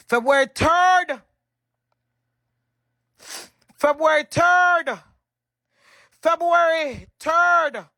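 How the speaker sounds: slow, loud, with "third" drawn out; emphatic proof. Real, not acted. Woman showing viewers the chat dates, emphatic. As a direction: hit "third" hard and stretch it.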